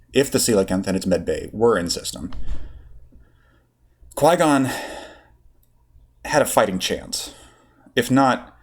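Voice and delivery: plain reading voice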